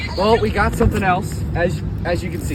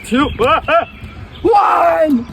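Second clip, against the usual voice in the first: excited countdown; 11 semitones higher, much slower than usual. Real, not acted. Countdown before casting.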